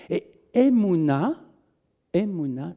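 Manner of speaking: Emouna drawn out slowly, rising, in Hebrew sounds; Emouna, slow and clear; Hebrew word said slowly. Shown as a demonstration. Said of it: "je vous donne ces trois consonnes de Emouna".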